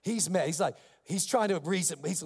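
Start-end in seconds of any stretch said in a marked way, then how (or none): none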